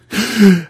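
sudden loud, high intake of breath; big gasp. Retold or imitated. Storyteller imitating his friend's first breath, big gasp. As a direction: imitate a revived man's huge gasp. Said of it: "He took a really big de-, breath".

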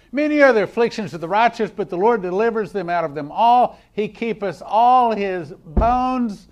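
high, loud scripture proclamation; full-force preaching. Acted. Preacher proclaiming the verse to the congregation, full-force preaching. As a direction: ring the verse out, stretching the last phrase.

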